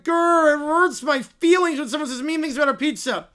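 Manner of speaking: put-on high, loud indignant kid voices; outraged kids. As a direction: protest the zero like a spoiled child. Kids protest the score.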